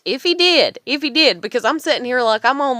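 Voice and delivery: rising agitation, repeating yourself; worked-up outburst. Real, not acted. Agitated over a man's behaviour.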